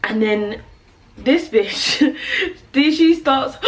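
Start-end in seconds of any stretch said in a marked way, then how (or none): 1.2-3.6 high, laughing through the words; laughing while telling. Real, not acted. Recalling her sister's reaction, laughing hard. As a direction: tell it cracking up with laughter.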